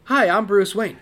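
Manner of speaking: loud, bright playboy voice; cheery millionaire introduction; normal Bruce Wayne voice. Retold or imitated. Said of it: "do their normal voice as Bruce".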